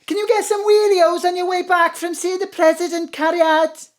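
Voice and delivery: Ma'am calling after her. Acted. Ma'am calls up the stairs.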